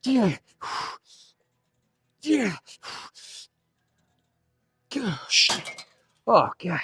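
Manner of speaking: real agony of the last reps; straining in pain. Real, not acted. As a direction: cry out through the muscle burn. Straining through a burning drop set.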